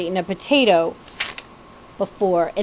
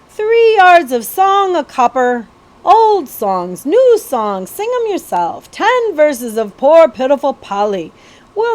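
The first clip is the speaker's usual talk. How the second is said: loud, high, slow ballad seller's cry; crying out wares; 5 semitones higher, 6 dB louder, slower than usual. A narrator's reading. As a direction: hawk songs across the fair crowd. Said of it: "crying out his wares".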